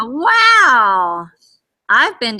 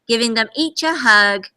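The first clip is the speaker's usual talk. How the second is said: ordinary delivery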